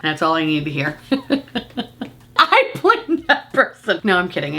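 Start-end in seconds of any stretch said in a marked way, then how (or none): 1.0-4.0 slow, higher, laughing through it; laughing blame. Acted. Teasing the viewer who told her, laughing.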